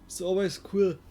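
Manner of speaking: yawning through the words. Real, not acted. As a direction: talk mid-yawn, sleepy. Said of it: "sorry for yawning".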